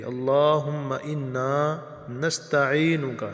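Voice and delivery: اللہم انا نستعینک with long vowels, slow; teacher's slow model recitation; slow careful recitation. Shown as a demonstration. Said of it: "تھوڑا سا لمبا کریں گے".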